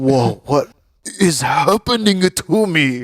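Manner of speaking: put-on slow, wondering little-boy voice; a kid shocked at his body; awed boy. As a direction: marvel like a kid. Boy's awakening, awed.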